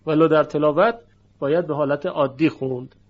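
ordinary talk, nothing marked

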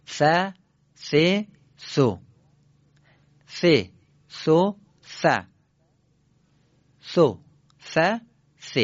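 ث said as a th with the tongue between the teeth; careful, clear ث for students to copy; teacher models th sounds; th syllables in threes. Shown as a demonstration.

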